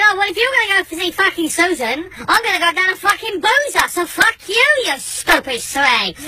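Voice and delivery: high-pitched angry retort, slowing on the insult; heated row. Retold or imitated. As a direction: snap back at your wife mid-argument. Row with wife.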